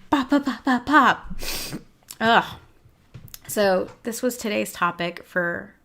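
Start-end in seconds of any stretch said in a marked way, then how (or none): 0.1-1.4 higher, louder popping sounds; goofy mouth pops; popping noises. Pretend. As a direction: pop pop pop as a silly distraction. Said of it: "insert deflection".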